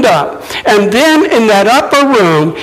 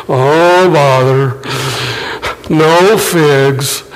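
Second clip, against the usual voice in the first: lower, droopy Eeyore mope; 7 semitones lower, much slower than usual. Retold or imitated. Preacher mocking a gloomy Jesus at the fig tree, lower Eeyore mope. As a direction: sigh it out glumly like Eeyore. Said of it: "sounded like Eeyore".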